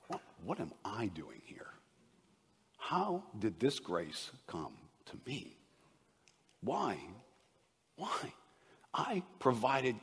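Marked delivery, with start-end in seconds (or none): 0.1-7.0 hushed, whispered wonder; awed whisper. Acted. Believer marvelling at being saved, whispering. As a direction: whisper it to yourself in disbelief.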